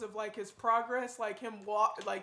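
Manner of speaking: loud, carried-away talk right by his ear; talking way too loud into the mic; yelling. Real, not acted. Partner too close to his ear, talking loud. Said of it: "You're yelling?".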